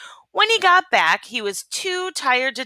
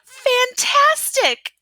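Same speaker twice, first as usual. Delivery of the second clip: much higher, louder, slow drawn-out fantastic; mock amazement; 5 dB louder, much slower than usual. A narrator's reading. Princess flattering the dragon's fire, slow mock wonder. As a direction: gush to egg on the dragon.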